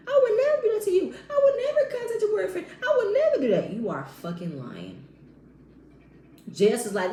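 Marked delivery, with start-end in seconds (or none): 0.1-3.7 high, loud imitation of indignant protest; hammed-up, high-pitched innocent outrage; mocking denial. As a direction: mimic her protesting in a high squeal. Mimics castmate's indignant denial.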